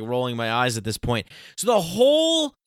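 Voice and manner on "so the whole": much higher, louder outburst; excited rant. Real, not acted. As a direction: hit the top of your voice in disbelief. Heated rant about Catholicism.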